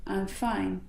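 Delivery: soft, low and dull "I'm fine"; a listless "I'm fine" with no energy; flat tone. Shown as a demonstration. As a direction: say it like you're bored and tired. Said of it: "compare it to the flat tone".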